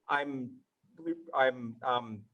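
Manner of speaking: usual speaking voice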